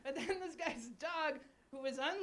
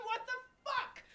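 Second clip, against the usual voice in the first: mock outrage; 11 semitones higher than usual. Incredulous punchline.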